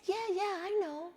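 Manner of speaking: lightly voiced child, shrugging it off; child's voice. Mother quoting her son's reply, high child voice. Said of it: "And he said".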